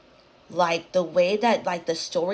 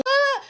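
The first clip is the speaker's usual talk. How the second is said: sudden high, loud mock scream; imitated scream; 7 dB louder than usual. Storyteller imitates the spirits' outburst, mock screaming. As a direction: burst into an imitated shriek. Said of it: "that's literally what the fuck it sounded like".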